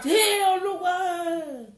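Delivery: high, loud, long-drawn wail; anguished shout. Retold or imitated. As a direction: cry out to God, stretching every word. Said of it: "He just shout".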